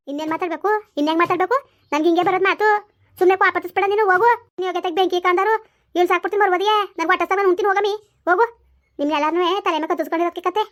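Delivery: loud, rising angry tirade; angry scolding. Acted. Mother-in-law shouting at her daughter-in-law, angry. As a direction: shout her down, furious and fed up.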